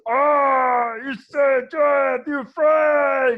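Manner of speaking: high, loud shouted troll joy; overjoyed troll shout. Acted. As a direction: yell it while squeezing them in a hug. Troll hugs party, shouting.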